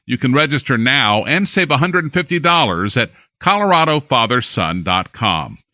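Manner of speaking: ordinary talk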